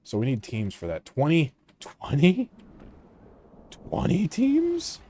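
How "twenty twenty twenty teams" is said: incredulous. Real, not acted. Game demands too many teams.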